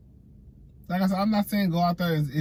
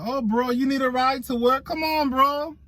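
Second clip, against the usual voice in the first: friendly coworker voice; 10 semitones higher, 3 dB louder than usual. Retold or imitated. Voicing Mexican coworkers offering ride.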